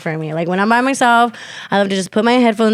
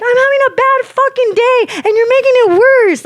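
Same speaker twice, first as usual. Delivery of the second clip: high, wailing re-enacted complaint; retold screaming and crying; 14 semitones higher, 3 dB louder than usual. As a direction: wail it out, pitched way up and louder at the end. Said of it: "I just started crying".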